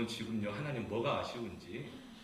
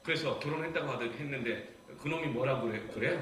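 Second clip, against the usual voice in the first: ordinary talk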